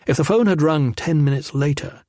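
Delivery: ordinary delivery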